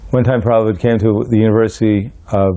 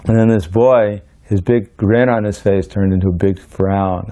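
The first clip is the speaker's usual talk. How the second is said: normal talking voice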